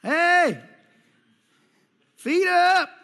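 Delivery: high, loud hollering shepherd call; shepherd's call. Retold or imitated. Shepherd calling his sheep with a high, loud holler. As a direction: holler out to the flock across a field. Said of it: "They got noises. They're like".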